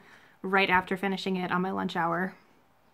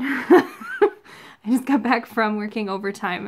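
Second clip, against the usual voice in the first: laughing; 4 dB louder, slower than usual. Real, not acted. Laughs about the cold.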